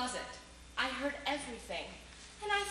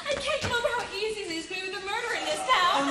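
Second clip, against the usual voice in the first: higher and louder, laughing through the words; laughing gloat; 5 semitones higher, 6 dB louder, faster than usual. Acted. Woman gloating to her lover, laughing. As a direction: delight spilling out as she talks. Killer gloats after murder.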